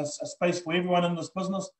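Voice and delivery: usual speaking voice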